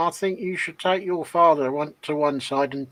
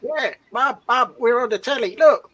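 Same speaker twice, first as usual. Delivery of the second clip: higher and bright, calling to mum; excited kid voice; 6 semitones higher than usual. Retold or imitated. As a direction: play an excited child shouting look. Echoes the excited kids' quote.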